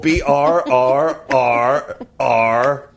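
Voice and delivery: B R R R R drawn out letter by letter; B R R R R spelled slow and stretched; spelled out slowly. Shown as a demonstration. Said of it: "Burr like cold".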